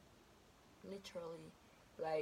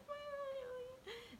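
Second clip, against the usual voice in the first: laugh-cry; 6 dB quieter than usual. Real, not acted.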